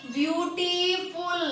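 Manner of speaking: Beautiful drawn out slowly, syllable by syllable; teacher stretching beautiful for students; slow read-aloud. Shown as a demonstration. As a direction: sound out beautiful slowly so the class hears each syllable. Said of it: "पढ़ो".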